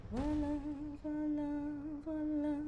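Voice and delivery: high sing-song voila voila voila; playful voila. Real, not acted. Streamer showing chat the new window, sing-song. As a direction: present it like a magic trick.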